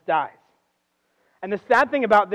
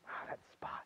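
hushed aside; 23 dB quieter than usual. Retold or imitated. Mimics someone at a Bible quiz.

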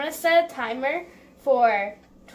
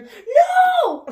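panicked shout; 12 dB louder than usual. Real, not acted. Stain on outfit she must wear.

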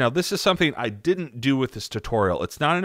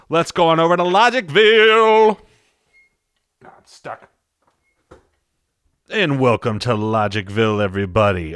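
much higher, louder, game-show announcer call; hyped announcement; 4 semitones higher, 8 dB louder than usual. Acted. Sending viewers to Logicville, loud and high like an announcer. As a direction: sell "Logicville" like a TV host.

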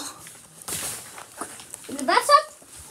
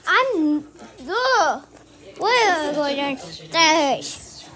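normal talking voice

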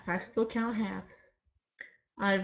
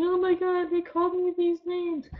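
high, louder mock wail; mock crybaby; 10 semitones higher, 4 dB louder than usual. Vlogger mocks a hurt softie, high hammed-up whine. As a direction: ham up a whiny softie about to cry. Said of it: "softie and crying right now, going".

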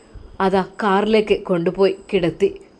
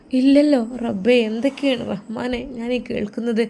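distressed mother; 3 semitones higher than usual. Acted.

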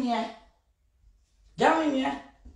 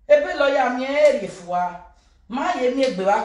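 usual speaking voice

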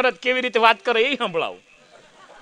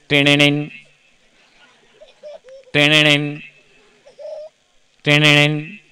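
ringtone; 7 semitones lower than usual. Retold or imitated. Imitating a phone ringtone.

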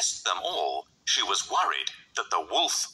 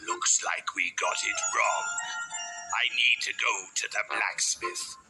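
scheming wolf. Acted. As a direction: demand it as the big bad wolf. Wolf character in acted scene.

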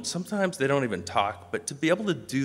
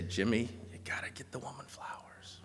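very soft imitated whisper; confiding whisper; 2 semitones higher, 11 dB quieter than usual. Ken confiding to Jimmy about flowers for Sandy, whispered. Friend confides, retold softly.

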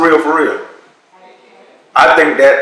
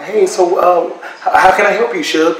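timid; 4 semitones higher than usual. Retold or imitated. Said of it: "I balled up, went in the corner".

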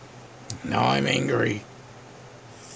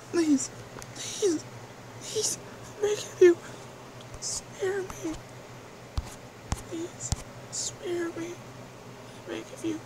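high pleading, fading quieter; desperate begging; 5 dB quieter than usual. Acted. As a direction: beg for mercy, growing weaker. Begging for mercy.

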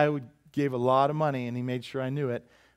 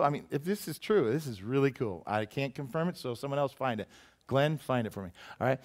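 ordinary delivery

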